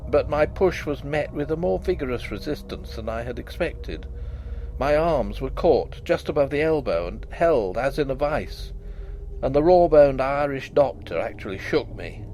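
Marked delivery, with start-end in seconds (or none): none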